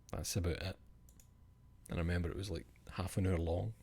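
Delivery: usual speaking voice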